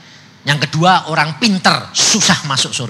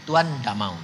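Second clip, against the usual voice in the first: ordinary talk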